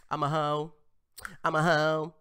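mock defiant boasting. Host imitating defiant woman from clip, loud mock boasting. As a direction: proclaim it loudly, parodying her defiance.

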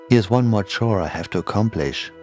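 ordinary delivery

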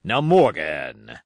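low, slow, stern address. A narrator's reading. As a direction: call him to account, drawing it out. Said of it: "said Long John very sternly".

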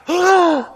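sharp, loud mock gasp; shocked gasp. Preacher acting out the congregation's reaction, shocked gasp. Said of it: "you would all be like".

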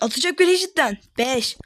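ordinary talk

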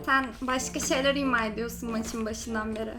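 put-on soft thin anime girl voice; quiet cutesy e-girl voice; man doing a woman's voice. Holds girl voice in game.

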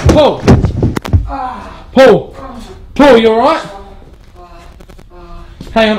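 loud high calling out; panicked shouting. Real, not acted. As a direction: shout for him in alarm. Friend fell into cellar.

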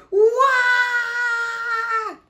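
very loud, high, sustained wail; baby holler. Acted. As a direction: bawl at full volume like a baby. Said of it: "hollered Baby Pig".

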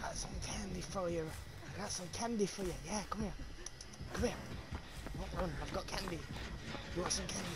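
low, quiet, coaxing stranger voice; quietly creepy candy offer; creepy candy man. Pretend. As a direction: lure them in like a creepy stranger with sweets.

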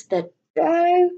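lively storybook reading; reading to children. A narrator's reading. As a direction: read it aloud to little ones, full of wonder. Reads a picture book to children.

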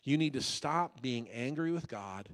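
ordinary talk, nothing marked

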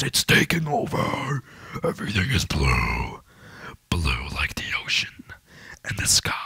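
low, creepy whisper; eerie whisper. Pretend. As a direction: whisper it like a ghost story. Spooky whisper about the blue.

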